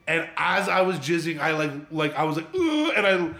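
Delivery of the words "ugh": high-pitched acted "ugh"; a sudden strained high grunt; mock climax grunt. Storyteller acting out his climax, mock grunt.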